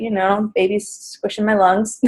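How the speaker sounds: ordinary delivery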